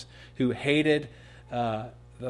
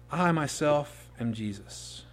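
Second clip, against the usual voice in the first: slow solemn declaration; slower than usual. Acted. Divine declaration repeated.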